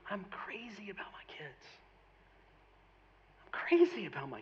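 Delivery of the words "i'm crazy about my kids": hushed whisper to the congregation; whispered like a heartfelt confession; confiding whisper. Real, not acted. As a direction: drop right down and whisper it. Preacher confides love for his kids.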